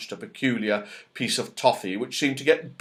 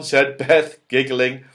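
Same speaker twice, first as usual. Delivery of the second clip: usual speaking voice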